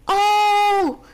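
high, loud, long cheer; joyful cheer. A narrator's reading. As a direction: whoop as everyone celebrates. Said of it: "大家高兴地大叫". (高兴地欢呼)

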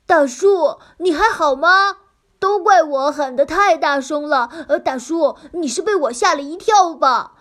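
loud, high anxious concern; worried calling. Acted. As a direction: rush to check he's hurt.

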